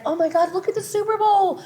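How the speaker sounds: squealing fake awe; mock gushing media. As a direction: parody breathless tabloid excitement. Mocking media hype.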